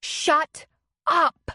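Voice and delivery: high, loud shouted order. A narrator's reading. Woman on the porch silencing the fighters, loud shout. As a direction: yell it to end the fight. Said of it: "Leslie shouted from the porch".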